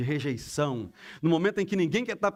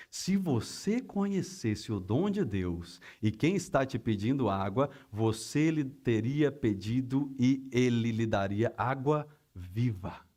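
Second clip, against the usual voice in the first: slow, measured, lower-pitched reading; solemn scripture reading; 6 semitones lower, 3 dB quieter, slower than usual. Retold or imitated. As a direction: let each phrase land, slowing into the last words. Reading Jesus's answer from scripture.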